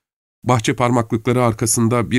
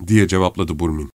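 ordinary delivery

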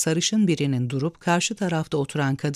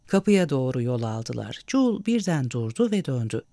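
usual speaking voice

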